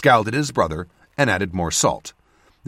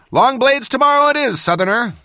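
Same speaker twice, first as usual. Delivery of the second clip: higher, louder call in reply; calling back; 14 semitones higher, 6 dB louder than usual. A narrator's reading. Dale answering Cadal across the camp, calling back. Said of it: "she called back".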